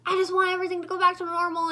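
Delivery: quick frustrated outburst, half hammed; mad. Shrek fed up with his life, mock angry. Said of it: "and he's all mad".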